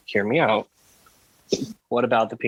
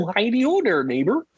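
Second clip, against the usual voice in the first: high froggy puppet voice; Kermit the Frog voice; 7 semitones higher than usual. Pretend. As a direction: greet like a cheerful frog puppet. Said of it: "that was pretty good".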